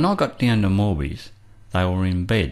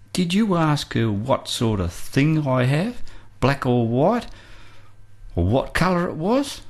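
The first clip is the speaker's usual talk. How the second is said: slow and deliberate, climbing higher and higher to the last words; rising challenge; 5 semitones higher than usual. Retold or imitated. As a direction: press each question harder, ending high and strained. Confronts the women, rising challenge.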